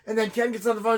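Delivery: ordinary delivery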